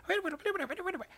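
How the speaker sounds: high, squeaky rapid "wait wait wait"; imitating a fast talker. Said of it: "like that".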